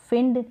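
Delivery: "फिंड" with short "ih", said on its own; wrong short i. Shown as a demonstration. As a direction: isolate the misreading "फिंड", short i. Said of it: "फिंड नहीं पढ़ेंगे".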